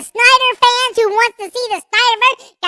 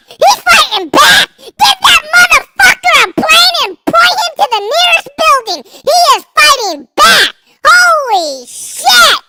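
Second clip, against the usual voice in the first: high, loud, frenzied hype shout; hyped-up shouting; 4 semitones higher, 5 dB louder than usual. Real, not acted. Streamer reacting to chat fighting back, excited shouting.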